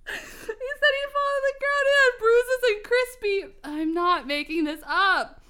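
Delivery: high, teary laughing voice; crying with laughter. Real, not acted. Reading the fic to her friend, crying with laughter. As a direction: talk through tears of laughter. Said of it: "I'm crying".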